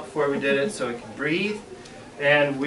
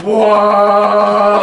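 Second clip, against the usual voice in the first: loud startled gasp of wonder; amazed whoa; 4 semitones higher, 10 dB louder than usual. Retold or imitated. Boy sees a huge bullfrog, awed loud whoa. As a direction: blurt out your awe. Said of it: "my heart went".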